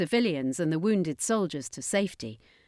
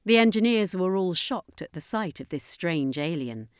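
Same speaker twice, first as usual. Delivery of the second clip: ordinary delivery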